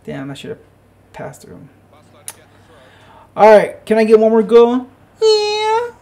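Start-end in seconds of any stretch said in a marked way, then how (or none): 3.4-5.9 loud, high-pitched cheer; loud plea rising into a high whoop; excited call. Real, not acted. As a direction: shout it with a soaring "yeah". Player calls for a goal, cheers.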